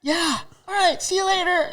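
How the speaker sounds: very high, held-out goodbye; mock breezy farewell.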